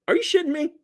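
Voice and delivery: high-pitched disbelief; incredulous. Real, not acted. Reader reacting to the card just drawn, high incredulous voice. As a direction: squeak it out in disbelief.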